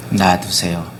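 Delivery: low, soft calm command; calm restraint. Retold or imitated. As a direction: quietly let it go like a king. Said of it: "다윗이 뭐라 합니까".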